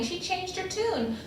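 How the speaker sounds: ordinary delivery, nothing marked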